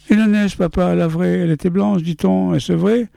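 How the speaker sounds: put-on high, wide-eyed child asking father; little boy voice. Son asks father.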